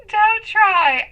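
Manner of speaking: ordinary delivery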